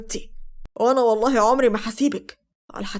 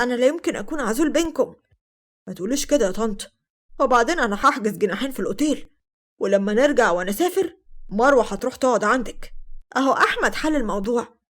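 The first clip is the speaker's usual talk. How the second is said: usual speaking voice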